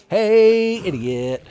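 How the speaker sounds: high, loud, drawn-out hey; calling out. Pretend. Player to an idiot enemy, loud taunting call. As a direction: holler to get an enemy's attention.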